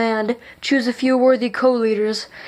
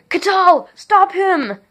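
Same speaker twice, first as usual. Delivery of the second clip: much higher panicked call for help; desperate yell; 8 semitones higher, 3 dB louder, slower than usual. A narrator's reading. King yelling to his guard Catal, panicked. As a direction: cry out for the guard to stop him. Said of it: "King Puramalum yelled".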